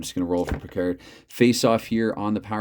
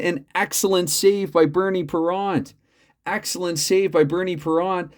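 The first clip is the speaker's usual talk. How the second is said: higher, lively announcer call; hockey play-by-play; 9 semitones higher, 4 dB louder than usual. Acted. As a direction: call the action like a broadcast announcer.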